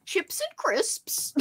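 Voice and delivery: put-on high, slow, silly British accent; mock British accent. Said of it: "I'm just using weird, like, tones".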